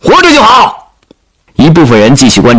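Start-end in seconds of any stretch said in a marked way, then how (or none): 0.0-0.9 high, called-out answer; shouted reply. Acted. Commander replying to the troops, high shouted relief. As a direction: shout it back across the field.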